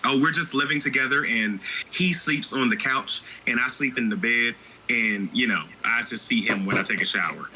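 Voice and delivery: normal talking voice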